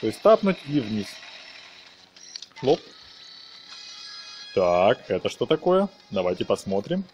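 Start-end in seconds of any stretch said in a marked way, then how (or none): none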